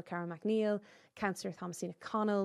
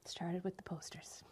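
breathy aside whisper; 11 dB quieter than usual. Real, not acted. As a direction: whisper it under your breath. Host's soft aside.

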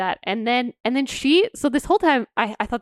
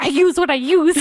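higher, louder defensive retort; hammed-up angry comeback; mock mad; 7 semitones higher, 7 dB louder than usual. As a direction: play an indignant healer shutting down criticism. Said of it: "She's so mad".

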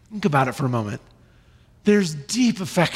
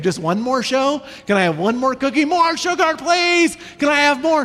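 put-on higher, whiny child pleading; child pestering parent for more, high and whiny; begging child; 7 semitones higher than usual. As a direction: beg like a kid for sugar. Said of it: "They do not mind asking".

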